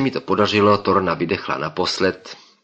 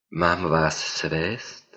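very slow, softer sultry lady's voice; seductive woman; 2 semitones lower, 5 dB quieter, much slower than usual. Acted. Woman driver offers the narrator a lift, slow and soft. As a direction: draw it out like a tempting stranger.

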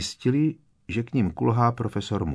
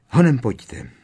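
urgent, louder hurry-up to friends; nervous urging; 3 semitones higher, 7 dB louder than usual. Acted. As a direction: push them along, anxious. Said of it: "vyzvala Harryho a Rona nervózně".

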